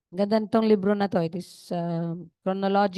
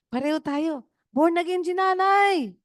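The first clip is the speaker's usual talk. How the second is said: realizing grandmother; 8 semitones higher, 4 dB louder than usual. Retold or imitated. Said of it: "Sabi niya".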